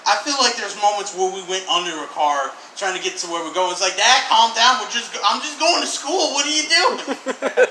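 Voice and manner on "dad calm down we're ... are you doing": put-on high, pleading kid voice; panicked kid in the passenger seat. Pleading with dad driving.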